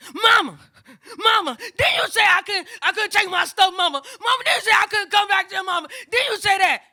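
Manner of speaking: high, loud, insistent wailing demand; upset confrontation. Retold or imitated. Granddaughter confronts her mama on speakerphone, high upset shout. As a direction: cry out at mama, rapid and pleading.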